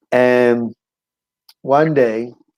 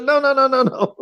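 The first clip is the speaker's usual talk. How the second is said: high rapid-fire string of refusals; emphatic refusal; 12 semitones higher than usual. Retold or imitated. Man turning down prostitute's offer, high emphatic refusal. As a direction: wave off the offer, pitched way up.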